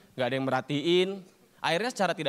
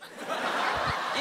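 imitated ghost laugh in one breath; kuntilanak laugh. Shown as a demonstration. Demonstrating the scariest ghost sound, kuntilanak laugh. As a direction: cackle like a kuntilanak on a single breath. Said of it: "suara kuntilanak ketawa".

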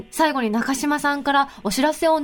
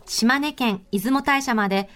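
sponsor ad read; 3 semitones lower than usual. Acted. Host reading a resort notice to listeners, measured ad read. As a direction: read the resort spot in unhurried phrases.

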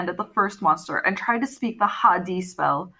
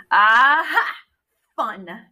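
gleeful; 2 semitones higher, 5 dB louder, slower than usual. Acted. Catwoman gleeful in the fight.

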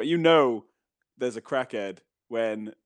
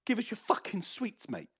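chav demand; 9 semitones higher, 7 dB quieter than usual. Retold or imitated. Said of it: "And he went".

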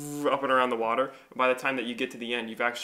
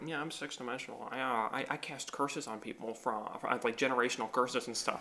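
snooty voice; 10 dB quieter than usual. Retold or imitated. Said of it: "they were so snooty and stuff".

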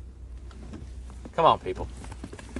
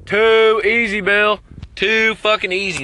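loud sarcastic jeer; mocking shout; 6 semitones higher, 6 dB louder, slower than usual.

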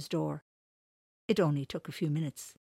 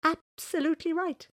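high, louder delighted agreement; huge smile; 13 semitones higher, 6 dB louder than usual. Acted. JC delightedly agrees with the old man, smiling. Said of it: "with a huge smile on his face".

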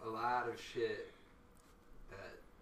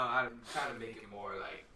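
ordinary delivery

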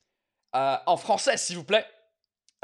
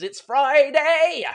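excited cheer; 7 semitones higher, 8 dB louder, much slower than usual. Acted. Toasting with a second beer.

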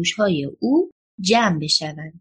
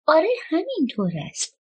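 put-on higher-pitched, impatient little girl; a touch of impatience, pitched up; 8 semitones higher than usual. A narrator's reading. Little girl answering questions about her missing father, impatient.

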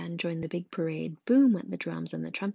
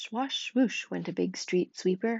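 ordinary delivery

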